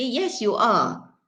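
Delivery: "Yes, you are" slow, with a full strong are; stress on are. Shown as a demonstration. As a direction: lean on are to insist. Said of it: "emphasize on the fact that the person is lazy".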